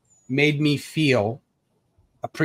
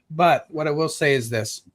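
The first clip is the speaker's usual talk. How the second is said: ordinary talk, nothing marked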